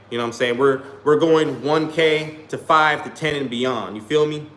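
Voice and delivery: usual speaking voice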